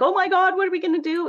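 high mock panic; hammed-up alarm at the crisis; panicky. Everybody at the start of covid, mock panic.

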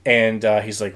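normal talking voice

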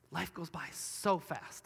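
slow reflective quote. Retold or imitated. Quotes great-grandma on deathbed.

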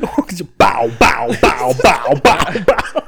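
punchy rapper ad lib chant; mock rapper ad lib. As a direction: mimic a rapper's ad lib bow bow. Imitating [name]'s ad lib.

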